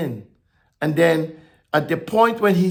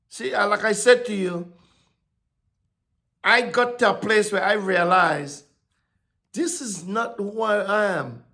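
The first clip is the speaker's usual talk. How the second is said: ordinary delivery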